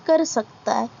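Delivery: normal talking voice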